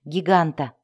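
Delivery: usual speaking voice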